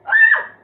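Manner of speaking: short, very high, loud shriek; sudden piercing yelp mid-game; real high scream; startled scream. Real, not acted. Sudden fright during the game.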